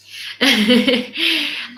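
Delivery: amused giggle; laughing. Real, not acted. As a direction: laugh after singing. Laughing after singing.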